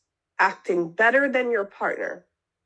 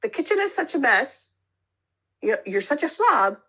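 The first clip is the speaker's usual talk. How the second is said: critical accusation; 6 semitones higher than usual. Shown as a demonstration. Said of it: "Instead of saying".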